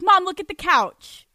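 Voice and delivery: high, loud mock excitement; bright retold exclamation; showing off to mom. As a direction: call mom over to admire it. Retold showing mother the couch.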